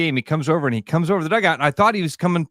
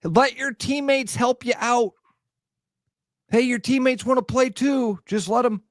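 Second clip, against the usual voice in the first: high mock-encouraging parent calls; sideline cliches; 10 semitones higher than usual. Parent calling cliches to their kid on the mound, mock encouraging. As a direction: rattle off cliches like a hovering parent. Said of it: "the cliches when a kid is on the mound".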